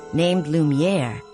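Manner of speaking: plain reading voice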